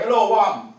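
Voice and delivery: put-on loud greeting in a Jamaican accent; imitating dad's Jamaican accent, loud and high. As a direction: answer the phone like a Jamaican dad. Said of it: "he still got that Jamaican accent".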